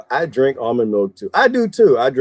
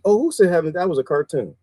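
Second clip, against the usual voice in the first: ordinary talk, nothing marked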